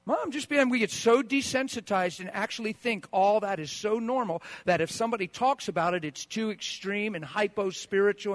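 loud, high-pitched pulpit fervor; fiery preaching. Real, not acted. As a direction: preach it hard to the congregation. Preaching at full force.

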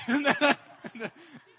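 high loud laugh; big laugh mid-story; loud burst of laughter. Real, not acted. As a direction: crack up laughing.

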